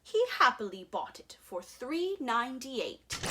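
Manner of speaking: plain reading voice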